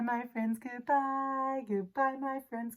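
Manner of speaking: usual speaking voice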